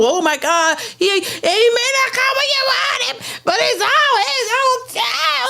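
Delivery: high hammed-up shout of fake amazement; mock surprise. Churchgoer mocked for acting surprised at the check, high shout. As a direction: act stunned by a blessing you saw coming, high. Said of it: "acting like it was a surprise".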